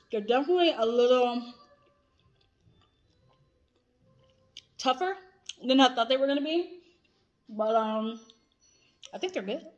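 chewy snack in mouth; talking while chewing. Real, not acted. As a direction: mouth partly full. Seen 0: holds snack piece, lips working and chewing between spoken phrases.

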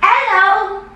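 high-pitched, teasing invitation; high mock-creepy playmate voice; playful put-on voice. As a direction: pipe it up high, playfully, in English. Playful put-on English line to stranger.

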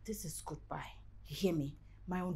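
very low, hushed farewell; quiet finality. Acted. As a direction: end it softly, barely above silence. Woman breaks up with boyfriend.

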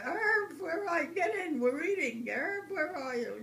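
high, loud call across the house. Retold or imitated. Mother calling Herb in to eat, loud high call.

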